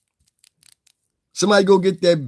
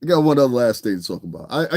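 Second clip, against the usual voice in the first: normal talking voice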